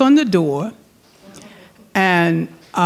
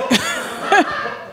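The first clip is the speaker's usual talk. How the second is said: laugh; 8 semitones higher than usual. Real, not acted. Laughing at her own joke.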